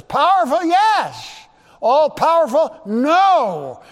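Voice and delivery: loud, high question and answer; emphatic preaching. Real, not acted. Preacher answering whether demons are all powerful, loud and emphatic. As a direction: fire out each question and answer at high volume.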